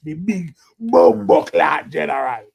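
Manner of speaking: slow booming name call; ring announcer voice. Pretend. Calls out his gimmick name.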